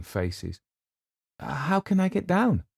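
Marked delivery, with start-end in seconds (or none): 1.4-2.6 much higher, plaintive and lost; begging for rescue, near tears; helpless. Acted. Eleanor stuck on the platform asks helplessly how to get down. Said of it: "she asked helplessly".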